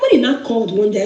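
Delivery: ordinary delivery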